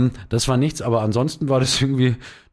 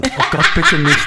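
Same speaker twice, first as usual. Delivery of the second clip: pleading dismay; 3 semitones higher, 5 dB louder than usual. Retold or imitated. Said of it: "ich so".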